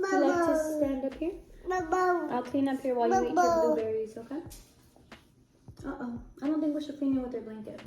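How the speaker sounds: ordinary talk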